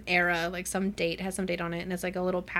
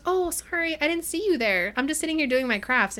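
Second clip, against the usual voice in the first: high, airy mock apology; playfully innocent, pitched well up; feigned innocence; 7 semitones higher than usual. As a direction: pretend you hadn't noticed him. Winter feigns surprise to tease guard.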